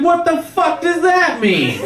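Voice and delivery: high, slow, baffled and laughing. Retold or imitated. Comic on waking from a dream, asks in high, slow disbelief.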